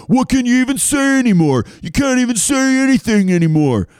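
high-pitched, whiny put-on complaint; mock indignation. Host parodying complainers about what's allowed, mock-indignant high whine. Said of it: "not me being like".

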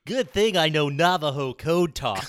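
slow, loud know-it-all character voice; hammed-up smug show-off; Bentley. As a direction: brag slowly, in character. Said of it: "do this next one in Bentley's voice".

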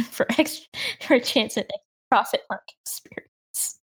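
words breaking up into helpless laughter; laughing while talking. Real, not acted. Player trying to explain the rule to the table, laughing. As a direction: voice squeezed to a wheeze by laughter. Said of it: "Sorry, I'm laughing".

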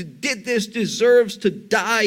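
usual speaking voice

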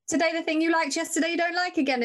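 high-pitched, exasperated scolding of a picky eater; exasperated mom. Retold or imitated. Mother scolding her picky son, exasperated and high.

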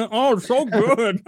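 mock Gordon faking it. Mocking Gordon pretending to enjoy her food, laughing and slow. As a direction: pretend to savour it while laughing. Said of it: "he pretends like".

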